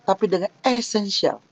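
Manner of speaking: ordinary talk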